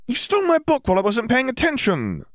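very high indignant accusation; outraged schoolgirl, pitched far up; indignant girl. A narrator's reading. Hermione glares, accuses Fleur of theft.

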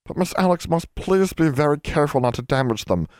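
high, imploring elf voice; squeaky anxious plea; pleading elf. A narrator's reading. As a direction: beg earnestly as a little elf. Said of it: "pleaded Bran".

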